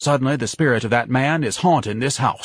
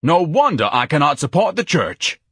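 understated, higher-pitched bellow; 8 semitones higher, 5 dB louder than usual. A narrator's reading. Ignatius reacting to the priest's advice, bellowing. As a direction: boom it out with mild indignation. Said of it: "Ignatius bellowed".

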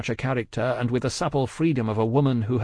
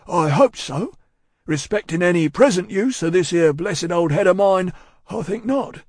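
loud, raised, rustic character voice; solemn Cockney servant; 7 semitones higher, 5 dB louder than usual. A narrator's reading. Cruncher answering Miss Pross, solemn and earnest. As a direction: declare it earnestly, well above your usual pitch.

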